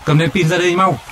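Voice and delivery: high urgent call from afar; shouting. A narrator's reading. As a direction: yell it across the riverbank. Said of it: "hét toáng lên".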